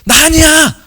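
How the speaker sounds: high, loud cry of denial; mock panicked shout. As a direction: yell it out in comic desperation. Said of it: "뭐라고 소리 질렀을 것 같아요?".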